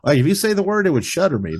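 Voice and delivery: normal talking voice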